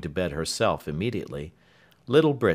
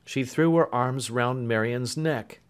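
plain reading voice

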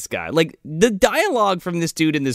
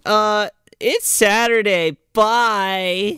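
slow, higher put-on teen voice; mock snotty brush-off; 5 semitones higher, much slower than usual. Friends ditch Julie on Saturday, mock snotty and drawn out. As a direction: drag out an imitated dismissal.

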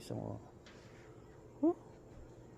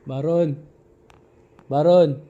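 shouting a name at full volume toward the house; calling out to wake the man inside; 7 semitones higher, 24 dB louder, slower than usual. Real, not acted. Final loud calls.

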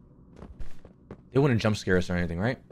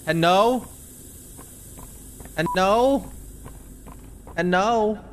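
calling out; 9 semitones higher, much slower than usual. Real, not acted. Calling out in the dark.

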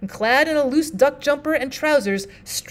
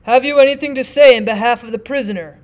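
a touch of the stern judge, louder; 9 dB louder than usual. Acted. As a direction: judge asks the partner, lightly voiced. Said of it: "said the judge finally".

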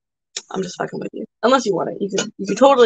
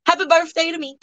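normal talking voice

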